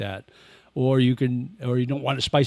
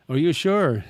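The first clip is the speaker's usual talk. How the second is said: higher, mock disbelief; doubtful waiter; 6 semitones higher, 5 dB louder than usual. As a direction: play the server doubting his order. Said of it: "they'll look at me like".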